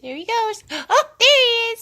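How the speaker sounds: excited. Real, not acted. Mother delighted by the baby.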